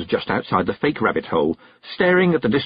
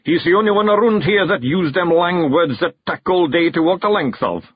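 higher, complaining Scottish voice; Scots clansman, grumbling; 9 semitones higher, 4 dB louder than usual. A narrator's reading. Big Yan complains about the toad's long words, grumbling.

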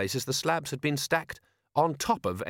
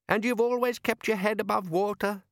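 put-on much higher eager boy's voice; young boy voice; 10 semitones higher than usual. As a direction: pitch up like an eager lad. Son character speaking.

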